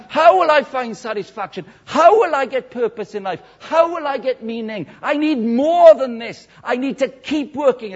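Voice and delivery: high, insistent mock anguish; dramatic for impact. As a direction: pile up each desperate question with force. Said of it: "I'll do it twice for impact".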